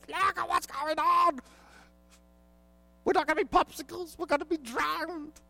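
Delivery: high, frantic disciples' cry; panicked disciples. Acted. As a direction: shriek at the sleeping Jesus.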